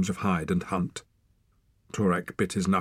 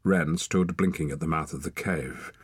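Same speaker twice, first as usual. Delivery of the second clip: ordinary delivery, nothing marked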